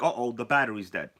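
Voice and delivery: ordinary talk, nothing marked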